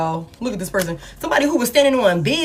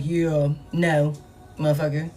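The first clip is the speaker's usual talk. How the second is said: low, flat firing; a boss coolly firing someone; cold boss voice; 6 semitones lower, slower than usual. Retold or imitated. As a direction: drop the voice low and dismiss them. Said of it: "It's like".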